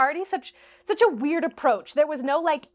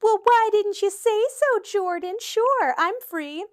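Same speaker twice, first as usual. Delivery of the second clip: high, louder, sarcastic fake-impressed gush; mock swooning; 9 semitones higher, 5 dB louder, slower than usual. Mocking the soccer player by pretending to be impressed. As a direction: gush as if instantly won over, dripping sarcasm. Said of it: "as if that was going to impress me and be like".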